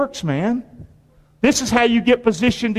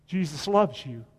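softer, lower, humble delivery; bow down and say it gently; low and gentle; 5 semitones lower, 9 dB quieter than usual. Shown as a demonstration. Pastor showing the humble way to reach people, soft and low. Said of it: "But if you're like this".

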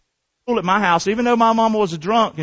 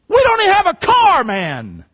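high, loud disbelief then dropping off; sudden high incredulity; incredulous protest; 11 semitones higher, 4 dB louder than usual. Retold or imitated. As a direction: protest like a surprised teenager. Teen protesting to himself.

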